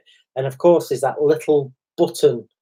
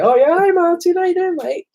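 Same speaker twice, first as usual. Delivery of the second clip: much higher, bright recognition; suddenly recognizing an old friend, voice jumps up; delighted greeting. Retold or imitated.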